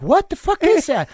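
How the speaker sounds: high-pitched baffled outburst; stunned disbelief. Retold or imitated. Recalling his first Devo show to the host, high baffled outburst. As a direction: jaw dropped at the weirdest band ever.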